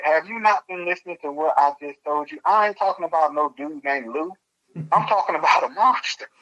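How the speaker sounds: high-pitched, incredulous protest; exasperated retort. Retold or imitated. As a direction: re-enact being totally misunderstood, pitch jumping up. Retold exasperated retort to boss.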